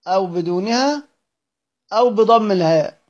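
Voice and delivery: usual speaking voice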